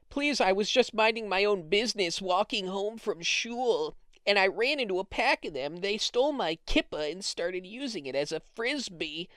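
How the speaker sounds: put-on pitiful plea to the police; mock distressed victim. Robbed man walking home from shul, mock plaintive. Acts out a robbed caller's chat.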